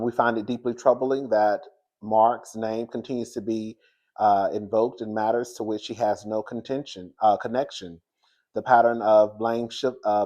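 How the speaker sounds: ordinary delivery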